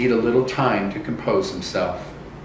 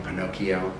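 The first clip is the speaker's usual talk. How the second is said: plain reading voice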